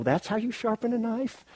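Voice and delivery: high, incredulous sneer at another's method; hammed-up disbelief pitched high; mock scoffing. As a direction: scoff at how they sharpen it. Mock rival knife sharpeners scoffing.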